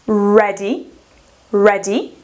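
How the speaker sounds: "ready" with a short open E, rising; teacher modelling "ready" for students, short E. Shown as a demonstration. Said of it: "E I A".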